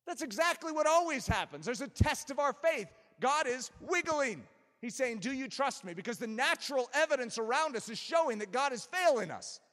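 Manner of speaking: high, insistent pulpit delivery; forceful preaching. Real, not acted. Preacher describes God testing our faith, forceful preaching. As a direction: press the point urgently on the congregation.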